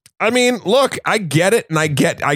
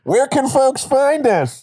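gas mask voice; 6 semitones higher, slower than usual. Pretend.

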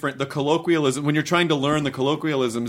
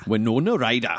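Geordie accent; 4 semitones lower than usual. Shown as a demonstration. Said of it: "You're a Geordie".

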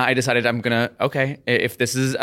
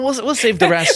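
laughing; 11 semitones higher, 4 dB louder than usual. Real, not acted. Joking banter, laughing.

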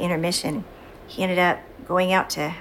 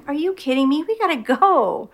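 exasperated mom; 8 semitones higher, 3 dB louder than usual. Retold or imitated. Mom scolding son for being late, exasperated. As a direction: hurry him along, fed up.

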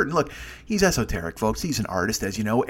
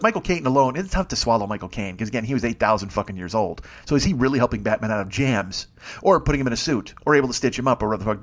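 ordinary talk, nothing marked

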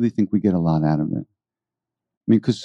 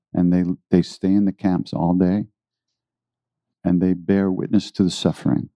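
ordinary talk

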